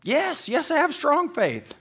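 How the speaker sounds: very high, loud desperate yell; scared. Acted. Dangling tourist swearing his faith to the voice, scared. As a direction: insist frantically, drawing the words out. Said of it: "'cause he's scared".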